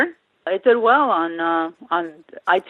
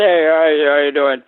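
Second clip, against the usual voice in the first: gangster voice; 4 semitones lower than usual. Retold or imitated. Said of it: "it was like a gangster".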